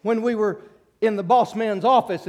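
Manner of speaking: loud, high, ringing sermon voice building up; full-force preaching. Acted. Preacher to congregation about crossing the Jordan, loud ringing preaching. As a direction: preach it out to the whole church.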